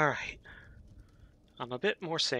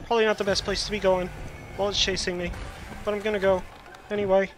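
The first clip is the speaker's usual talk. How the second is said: fast, loud, raised nervous talk; scared horror game streamer; 4 semitones higher, 5 dB louder, faster than usual. Real, not acted. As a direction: rattle it off in panic. Fleeing the monster.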